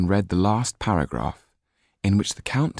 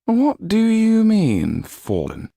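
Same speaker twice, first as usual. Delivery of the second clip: slow, high, loud question; incredulous demand; 5 dB louder, much slower than usual. A narrator's reading. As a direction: demand it in disbelief. Marquis disbelief.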